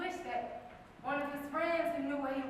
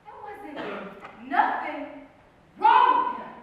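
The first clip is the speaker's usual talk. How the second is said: emphatic insistence; 11 dB louder, slower than usual. Acted. Narrator defends the man, emphatically building. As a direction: punch each part, bursting out on the last.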